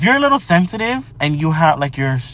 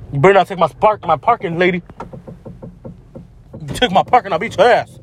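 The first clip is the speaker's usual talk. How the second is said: scolding a driver; 3 semitones higher than usual. Real, not acted. Yelling at driver over parking.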